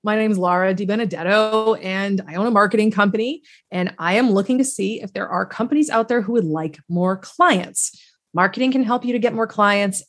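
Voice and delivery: charming cold-call pitch. Acted. Cold caller asking a prospect for a meeting, playfully charming. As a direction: playfully sell it, easing into a tentative ask. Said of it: "And then I'd be like".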